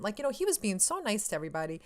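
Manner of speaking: usual speaking voice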